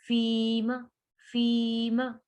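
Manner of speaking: फीमा with long "fii" and short "ma"; stretched fii. Shown as a demonstration. Teacher showing the stretch, फीमा with long "fii". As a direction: hold the first vowel of फीमा, cut the second short. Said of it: "फी को खींचेंगे".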